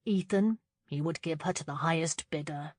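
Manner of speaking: plain reading voice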